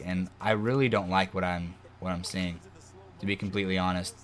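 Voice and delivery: ordinary talk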